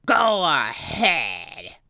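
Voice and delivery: slow, a touch gruff pirate; mild growl. Acted. Captain lets the children speak, a mild growl. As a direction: drag it out, menacing. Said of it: "growled Captain Bones".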